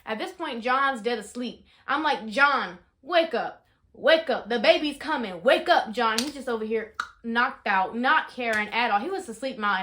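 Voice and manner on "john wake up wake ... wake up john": louder urgent call to a sleeping husband; retold loud wake-up calls. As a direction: shake him awake with your voice. Retelling trying to wake husband.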